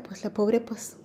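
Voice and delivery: hushed whisper, barely voiced. Real, not acted. Telling the interviewers the birth mother had nothing, whispered. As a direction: drop to a whisper as if sharing a sad secret.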